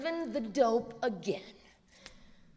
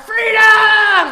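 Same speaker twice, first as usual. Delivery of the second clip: freedom! shouted much higher and louder; battle-cry freedom; 11 semitones higher, 17 dB louder than usual. Shown as a demonstration. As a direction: roar freedom! like a charge. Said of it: "Like that".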